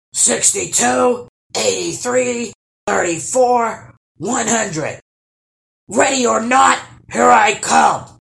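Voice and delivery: loud, high count building to a shout; yelling troll. A narrator's reading. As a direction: call out slowly as the seeker, loudest at the end. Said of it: "yelled the troll".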